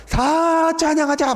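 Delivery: high, drawn-out rallying cry. Acted. Preacher calling everyone to praise together, drawn-out cry. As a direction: call out slowly to a whole crowd.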